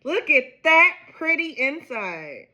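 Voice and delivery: high, loud squeals of delight; thrilled gasps. Real, not acted. Gardener cutting open her first homegrown cantaloupe, delighted squeals. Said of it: "so excited".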